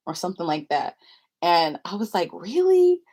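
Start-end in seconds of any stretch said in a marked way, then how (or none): 2.3-3.0 high, loud mock disbelief; retold incredulous question; surprised. Reacting to being taken for dates.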